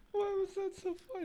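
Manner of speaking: high, squeezed laughing voice; helpless laughter. Real, not acted. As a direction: wheeze the words out through giggles. Cracking up at the joke.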